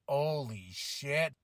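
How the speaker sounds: slow, hushed holy shit; stunned and breathless; whispered amazement; awed. Retold or imitated. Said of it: "I was like".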